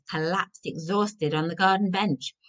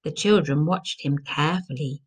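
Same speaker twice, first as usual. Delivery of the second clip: plain reading voice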